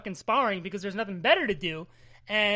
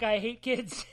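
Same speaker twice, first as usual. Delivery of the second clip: laughing. Retold or imitated. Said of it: "And Hepzibah's like".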